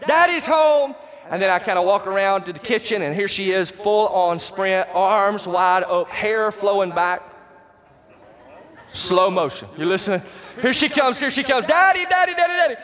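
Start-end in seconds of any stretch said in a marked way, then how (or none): 0.1-1.0 put-on little girl calling. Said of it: "heard her around the corner saying". 10.6-12.8 put-on high, eager child calling Daddy; squealing child voice, much higher; excited little girl yelling. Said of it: "That's what she's yelling".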